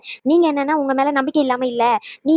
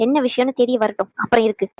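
normal talking voice

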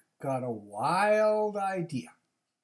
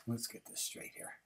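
ordinary delivery

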